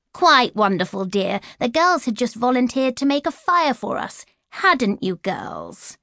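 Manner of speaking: lightly voiced sugary stepmother; mock-innocent stepmother; falsely sweet. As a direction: sweeten it for the husband, with a sting for the girls. Stepmother fakes sweetness to father.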